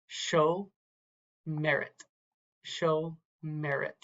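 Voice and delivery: slow "show merit" with gaps between the parts; broken into syllables. Shown as a demonstration. Teacher showing a word's syllables, "show merit" slowly broken up. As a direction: sound out "show merit" piece by piece. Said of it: "you also have three syllables".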